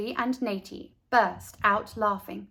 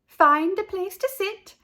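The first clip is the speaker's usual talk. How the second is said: much higher, louder, bright; cheerful teacher call; 10 semitones higher, 6 dB louder than usual. A narrator's reading. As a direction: call the class to lunch. Teacher addressing the class outdoors.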